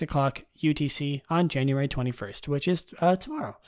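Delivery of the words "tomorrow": normal talking voice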